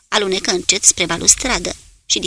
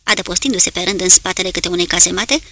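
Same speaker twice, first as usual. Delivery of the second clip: usual speaking voice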